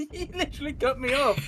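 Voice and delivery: laughing, high and loud; laughing protest. Real, not acted. Streamer protests being cut off mid-fact, laughing. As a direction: complain loudly through laughter.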